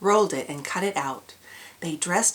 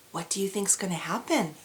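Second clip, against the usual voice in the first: plain reading voice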